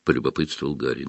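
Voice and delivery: ordinary delivery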